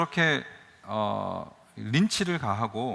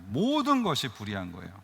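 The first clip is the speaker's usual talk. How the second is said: ordinary talk, nothing marked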